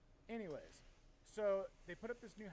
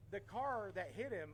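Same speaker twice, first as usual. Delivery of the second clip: usual speaking voice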